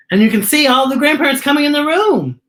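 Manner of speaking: excited announcement. A narrator's reading. Grandparents arrive at party.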